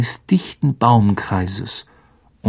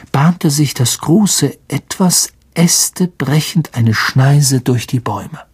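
ordinary delivery, nothing marked

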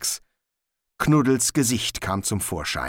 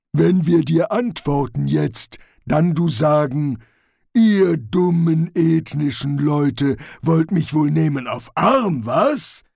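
loud, raised, ponderous troll voice; troll mimicking a sceptic; 6 semitones higher, 6 dB louder than usual. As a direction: act out the scoffing reply as a troll. Troll character voice. (Troll, laut und spöttisch nachahmend)

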